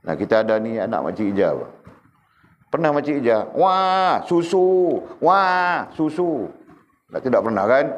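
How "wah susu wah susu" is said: put-on higher whiny baby wailing for milk; mock baby crying. Teacher voices baby wanting milk. (tiruan bayi merengek minta susu, suara tinggi)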